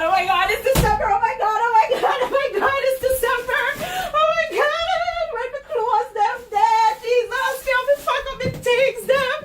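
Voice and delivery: high, squealing excitement; real excitement. Real, not acted. Realising it's December and Jamaica is coming, excited squealing. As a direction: gush in a high, excited rush. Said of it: "I'm so excited".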